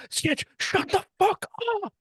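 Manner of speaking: very high, strained retort; exasperated mock yell. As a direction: imitate a coach at breaking point. Imitating Jinxie's exasperated outburst.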